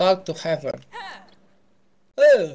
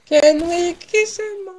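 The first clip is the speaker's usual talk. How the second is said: much higher, slow, louder gushing ask; mock swoon squeal; 10 dB louder, slower than usual. Player fawning over a character, high slow squeal. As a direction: gush it like a smitten fan.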